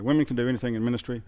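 rattled off quickly; fast. Shown as a demonstration. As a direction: rush through the line. Fast repeat of point.

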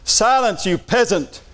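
high, imperious outburst, falling on each phrase; mock yell. Six-year-old fed up with his chattering sister, mock yell. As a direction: play a fed-up child yelling a grand command. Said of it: "And he yelled".

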